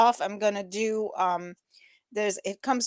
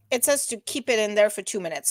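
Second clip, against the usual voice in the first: usual speaking voice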